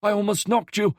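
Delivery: put-on startled old man. Startled driver scolds.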